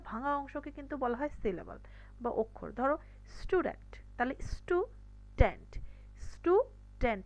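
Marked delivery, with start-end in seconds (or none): 4.5-5.7 student split in two, STU, pause, DENT; broken into syllables. Shown as a demonstration. Teacher splitting a word for students, broken into syllables. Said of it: "ভেঙে ভেঙে উচ্চারণ করতে পারি". 6.3-7.2 student in two separate beats, STU, DENT; broken into syllables. Shown as a demonstration. Teacher showing two syllables make one word, split apart. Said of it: "এখানে দুইটা অক্ষর মিলে একটা".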